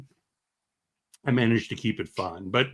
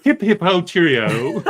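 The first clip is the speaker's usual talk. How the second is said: hammed-up posh English accent, higher, louder and slow; mock posh English; 7 semitones higher, 9 dB louder, slower than usual. Host teasing British guest Max with a mock posh English accent. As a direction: lampoon a cheery British toff. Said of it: "people with English type accents just sound the same".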